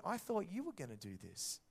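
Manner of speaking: usual speaking voice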